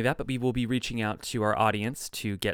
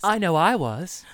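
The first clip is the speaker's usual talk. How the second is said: mock announcer; 6 semitones higher, 7 dB louder than usual. Playing along with announcer bit.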